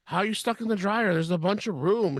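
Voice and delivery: high-pitched mock bafflement; incredulous mock questioning; baffled husband. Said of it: "And he's like".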